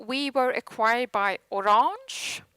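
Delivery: ordinary delivery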